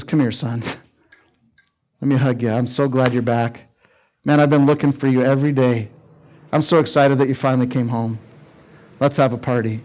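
soft, low, gentle welcome home; tender father. Acted. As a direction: hold him close with your voice.

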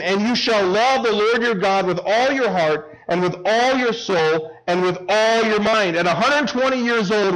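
impassioned proclamation held high in pitch; urgent preaching. Real, not acted. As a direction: drive each command home to the congregation. Preaches as Moses' urgent last words.

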